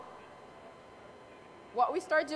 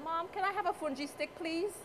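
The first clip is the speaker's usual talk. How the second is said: higher-pitched imitation of asking Mom; pleading request; 6 semitones higher, 4 dB quieter than usual. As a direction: ask sweetly for a favor, pitched up. Retold plea to her mother.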